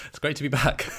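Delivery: high, laughing through the words. Real, not acted. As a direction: bubble over with laughter. Laughing while speaking.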